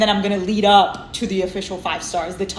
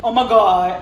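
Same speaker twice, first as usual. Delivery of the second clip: ordinary delivery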